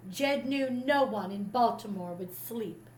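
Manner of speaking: plain reading voice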